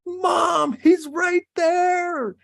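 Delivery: put-on high, drawn-out child's yell; kid's yell. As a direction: imitate a kid yelling to mom, stretching the words. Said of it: "he just yells".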